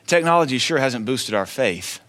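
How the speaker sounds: normal talking voice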